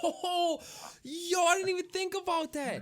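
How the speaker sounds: high, loud sudden excitement; light up at the new idea; excited. Real, not acted. Excited realization about Telltale style.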